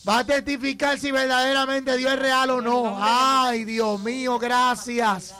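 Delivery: fervent, insistent prophetic proclamation; prophetic preaching. Real, not acted. Pastor promising Joana a testimony, fervent preaching. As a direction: drive each promise home with conviction.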